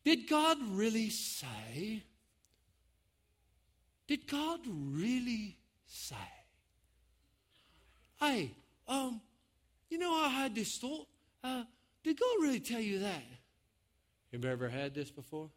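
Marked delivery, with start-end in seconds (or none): 0.0-6.1 slow, drawn-out tempting question; serpent's insinuation. Acted. Replaying the serpent's polite question. 8.2-13.2 soft, higher-pitched, tentative suggestion; casual tempter. Acted.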